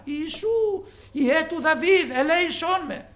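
high, loud pleading shout; loud cry for mercy. Retold or imitated. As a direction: shout it so he must stop. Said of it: "φώναζε δυνατά".

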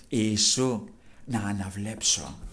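low, soft, slowing request; hushed pleading. Retold or imitated. Blind man asking Jesus for his sight, hushed pleading. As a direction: ask quietly, drawing out the wish.

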